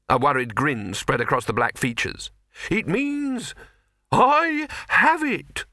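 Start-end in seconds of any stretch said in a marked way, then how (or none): 2.7-5.6 high, slow, dreamy exclamation; dazed alchemist. A narrator's reading.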